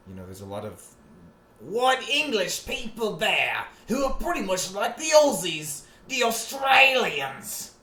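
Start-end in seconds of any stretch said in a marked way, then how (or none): 1.6-7.7 mock Aussie cheer. Mock Aussie bar shout.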